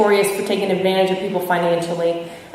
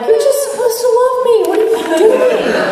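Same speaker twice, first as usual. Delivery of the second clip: very high, indignant mock whine; hammed-up squeal of wounded entitlement; mock resentment; 4 dB louder than usual. Granddaughter to grandfather reading his list, high resentful mock whine. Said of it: "immediate resentment".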